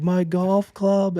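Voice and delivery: high, drawn-out mock wail; child's tantrum. Dad imitates his son losing the toy, tantrum wail. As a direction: imitate a kid wailing over a lost toy. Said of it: "he just threw a tantrum".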